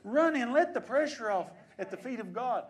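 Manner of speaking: loud preaching cry, pitch climbing; full-force preaching shout. Real, not acted. Pastor urging his church to run inside, full-force shout. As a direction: shout it to the back pews.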